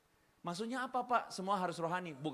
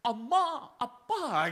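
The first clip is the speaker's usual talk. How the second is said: put-on much higher, louder girl voice; hammed-up K-drama girl; mock K-drama daughter; 6 semitones higher, 7 dB louder, much slower than usual. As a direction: play the Korean drama daughter. Girl answers parents, Korean drama.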